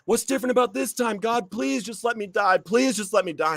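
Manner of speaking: high desperate cry to God; pleading yell, voice up high. Retold or imitated. Man in his car yelling at God, desperate high plea. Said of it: "I'm yelling at God".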